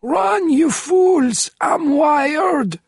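high slow frantic yell; screaming. A narrator's reading. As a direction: scream at them to flee. Warning them of bomb.

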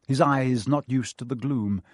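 usual speaking voice

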